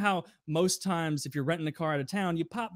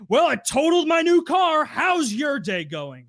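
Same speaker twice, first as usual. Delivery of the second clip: much higher and louder, then slow and biting; sarcastic furious customer; 11 semitones higher, 8 dB louder, slower than usual. Retold or imitated. Customer who wrecked his car snapping back, loud and sarcastic. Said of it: "And he's like".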